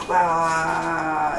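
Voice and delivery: high, held "aah"; drawn-out mock yell. Imitating the clash after the bell.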